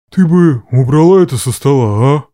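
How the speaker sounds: low, loud grim voice; gloomy. A narrator's reading. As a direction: say it darkly in a deep voice. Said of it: "мрачно кивнул Гран". (мрачно низким голосом)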